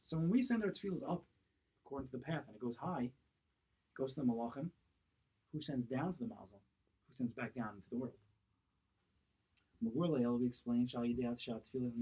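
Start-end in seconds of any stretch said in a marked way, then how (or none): none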